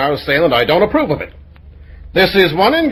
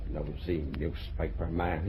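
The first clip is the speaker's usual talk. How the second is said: muttered insult; 11 semitones lower, 17 dB quieter, slower than usual. Acted.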